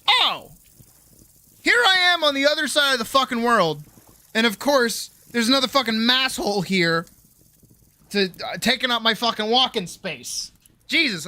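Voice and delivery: high, loud exasperated rant; hammed-up outburst at a fellow countryman; mock exasperation. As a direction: act out indignant disbelief, pitched high. Acted exasperation at fellow Bostonian abroad.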